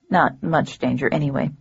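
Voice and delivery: ordinary delivery, nothing marked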